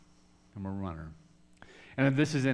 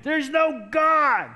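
slow, much higher, louder anguished shout; 12 semitones higher, 6 dB louder, much slower than usual. Retold or imitated. Alone at the bay, a slow anguished shout at the sky. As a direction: hurl it out over the water, stretched long. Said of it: "I started shouting it".

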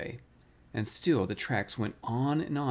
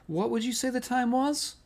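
much higher, questioning little voice; casual-sounding query; 11 semitones higher than usual. A narrator's reading. Piglet fishing for an excuse from Pooh, casual-sounding query. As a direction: break off and ask in a high, innocent voice.

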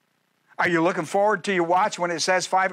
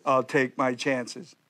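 mock casual; 6 semitones lower, slower than usual. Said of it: "who does that stuff".

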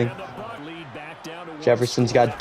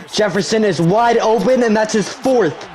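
higher, building excitement; excited play call; 7 semitones higher than usual. Real, not acted. Gamer calling his receiver's fourth touchdown, excited. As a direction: call the score like a thrilled fan.

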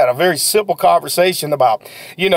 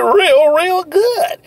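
real good pushed up much higher; emphatic praise; 10 semitones higher, much slower than usual. Acted. Sales coach hyping new sellers, real good pitched high. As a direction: stretch the praise up high to sell it.

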